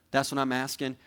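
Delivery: usual speaking voice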